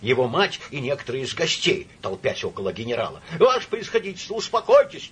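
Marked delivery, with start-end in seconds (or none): 3.4-5.1 high-pitched, loud pleading; alarmed, raised-voice appeal; urgent cry. A narrator's reading. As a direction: implore him loudly to calm down. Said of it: "кричал Псилдонимов".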